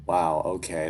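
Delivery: usual speaking voice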